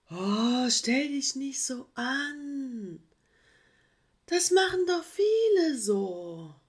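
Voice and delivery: high, slow, lazy excuse; making excuses. Acted. Sloth brushing off the koala's complaint, lazily. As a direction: shrug it off lazily. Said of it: "wollte Fergus sich herausreden".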